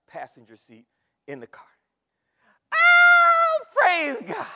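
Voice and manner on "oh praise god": high, loud burst of praise; joyful shout. Retold or imitated. Preacher reliving his joy, shouting praise. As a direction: shout it out like you just found treasure. Preacher reenacts finding the earring.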